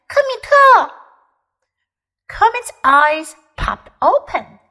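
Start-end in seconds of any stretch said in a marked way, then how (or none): 0.1-0.9 high, loud yell of the name. A narrator's reading. Scared little bear yelling to wake his friend. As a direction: shout it out to wake him. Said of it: "福子大喊了起来".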